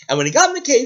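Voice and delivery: usual speaking voice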